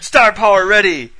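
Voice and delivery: loud, high video game announcer. Pretend. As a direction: boom it like a game voiceover.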